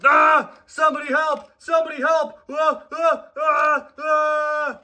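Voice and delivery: high, loud panicked shouts; frantic high cries for help; screaming for help. Acted. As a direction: yell for help in pain. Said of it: "screamed Charlie, clearly in pain".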